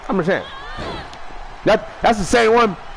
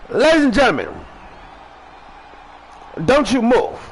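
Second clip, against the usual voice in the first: raised host announcement to the audience; announcer call; 4 semitones higher than usual. Acted. Host addressing viewers, announcer call.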